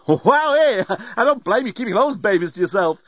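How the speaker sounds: high, loud, cheeky robot voice. A narrator's reading. Lamp to passenger covering herself, cheeky and high. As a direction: leer playfully in a high voice.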